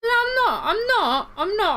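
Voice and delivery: squeaky, loud protest; high-pitched denial. Real, not acted. Denies binge-watching true crime.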